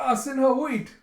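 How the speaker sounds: high, loud mock panic; alarmed cry. Jeha chased by love-struck Sinha, alarmed cry. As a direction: yelp out a plea to stop. Character calls out as pursued.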